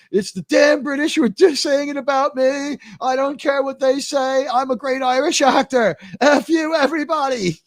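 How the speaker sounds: high-pitched mock tirade in an Irish accent; indignant Irish outburst, imitated; Richard Harris rant. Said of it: "they let him rant".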